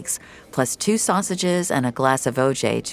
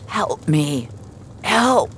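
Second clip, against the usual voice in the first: crying out; much slower than usual. Acted. Trapped man to rescuer, crying out for help. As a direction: call out from the wreck. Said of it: "he cried".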